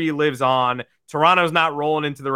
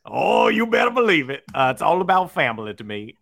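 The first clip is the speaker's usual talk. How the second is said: Brian Kelly; 3 semitones higher than usual. Acted. Host playing along when teased about his accent, hammed-up Southern. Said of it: "Brian Kelly with that accent".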